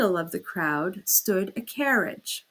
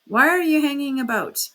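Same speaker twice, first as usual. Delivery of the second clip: angry porter; 6 semitones higher, 7 dB louder than usual. A narrator's reading. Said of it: "beginning to get angry in earnest".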